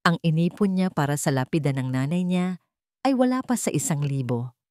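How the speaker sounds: ordinary delivery, nothing marked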